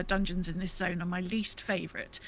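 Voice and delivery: normal talking voice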